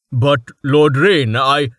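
loud, slow indignant plea; loud protest. Acted. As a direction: protest loudly, cut off. Hakim protesting loudly.

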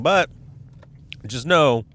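usual speaking voice

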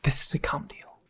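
hushed, voiceless whisper; scandalized whisper. Acted. Partner whispers his alarm about the shady deal. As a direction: share a dirty secret under your breath. Secret aside in the sauna.

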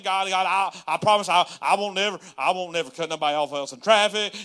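loud, stammering plea; desperate bargaining. Retold or imitated. Pastor mimicking his deal-making prayers to God, pleading loudly. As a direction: plead in loud bursts, tripping over the promises.

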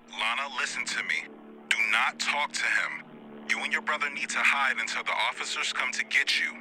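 urgent operator voice. Acted. Operator urging Lana to hide.

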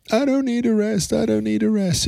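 imitating a kid's high-pitched mantra; protesting child. Father imitating his daughter refusing rest, protesting child.

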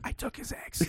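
hushed mock confession; sneaky whisper. Cashier confessing once customer left, sneaky whisper. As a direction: whisper it like a guilty secret.

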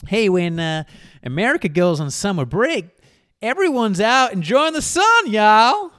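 loud, high-pitched hammy American accent; badly faked American y'all accent; attempted American accent. Shown as a demonstration. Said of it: "I'll try to do it in an American accent".

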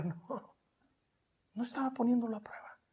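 fast whisper. Real, not acted. Preacher correcting a skeptic in the congregation, whispered. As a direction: brush the objection off softly.

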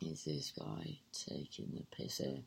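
hushed, breathy aside under the breath; incredulous whisper. Retold or imitated. Whispering her disbelief about the guy to the listener. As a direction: whisper it like you can't believe him. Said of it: "And I was like".

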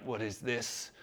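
low, very soft retold question; bewildered, under the breath; hushed bewilderment. Young man feeling tears come, quietly bewildered.

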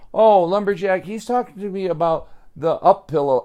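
mildly hammed-up eager boy, high and quick; eager reply. Joey explains to Lumberjack what he heard, eager.

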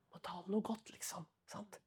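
sly hushed nudge; whispered coaxing. Retold or imitated. Leader whispering to the preacher, coaxing him to go easy.

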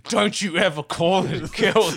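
hammed-up offended Scottish man; Scottish accent. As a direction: scold in a put-on Scottish accent. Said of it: "my Scottish accent wasn't that bad".

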